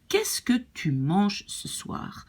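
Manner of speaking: slow, clear "Qu'est-ce que tu manges ce soir?" with kɛs kə run together; French model sentence. Shown as a demonstration. Teacher modelling the French question for learners. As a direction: read it out slowly as a model. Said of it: "practice to say this thing".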